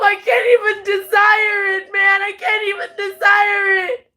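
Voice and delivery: tearful, half-shouted words breaking with sobs; real sobbing outburst; crying rant. Real, not acted. Breaking down over leaving home.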